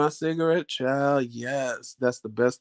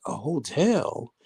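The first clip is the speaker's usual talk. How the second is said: incredulous; 5 dB quieter, much slower than usual. Retold or imitated. Surprised at the hotel.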